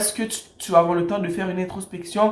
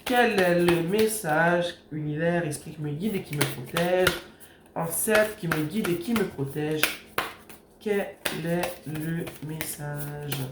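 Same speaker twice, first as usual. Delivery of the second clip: hushed invocation; 2 semitones lower, 4 dB quieter than usual. Acted. Tarot reader softly invoking her guiding spirits, hushed prayer. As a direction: call on the universe gently while shuffling.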